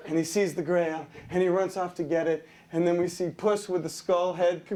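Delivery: ordinary delivery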